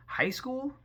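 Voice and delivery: can't believe they chose high school; incredulous. Real, not acted. Vlogger mocking the Cullens' cover story, squeaky disbelief.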